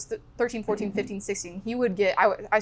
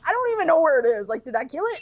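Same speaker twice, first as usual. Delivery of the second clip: frantic retold panic; 6 semitones higher, 4 dB louder, faster than usual. Kid after the shot.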